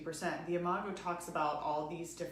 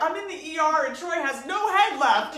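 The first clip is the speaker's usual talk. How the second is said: higher, louder mock emergency; mock hysteria; 8 semitones higher, 12 dB louder than usual. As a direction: ham up a dire hospital call. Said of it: "he's expecting me to be like".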